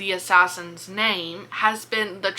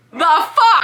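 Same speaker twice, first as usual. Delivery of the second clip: shocked outburst; 7 semitones higher, 8 dB louder than usual. Retold or imitated. Mandex finds Callie alive with assassin.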